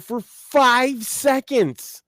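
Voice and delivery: high, drawn-out, insistent words; exasperated rant. Real, not acted. Explaining Dave to Sam, exasperated and drawn out. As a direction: stretch it out in high-pitched exasperation.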